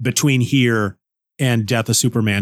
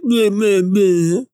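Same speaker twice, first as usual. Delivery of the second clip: mock blah; 6 semitones higher, much slower than usual. Podcaster mocking his own intro with a high blah. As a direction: brush it all off with a sing-song blah.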